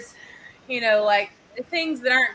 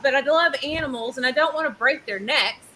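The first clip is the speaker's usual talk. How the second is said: ordinary talk, nothing marked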